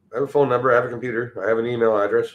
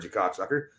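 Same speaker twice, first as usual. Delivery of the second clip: ordinary talk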